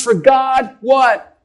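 usual speaking voice

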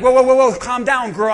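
loud whoa, whoa to calm her; friend calming her down. Retold or imitated. Ruth calming down an overexcited Naomi. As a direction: hold her back, loud and amused. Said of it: "Ruth and Oprah were like".

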